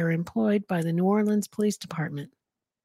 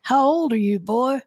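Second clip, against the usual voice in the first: higher, louder Southern officer's question; Southern accent; 5 semitones higher, 5 dB louder than usual. A narrator's reading. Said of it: "officer with a Southern accent asks".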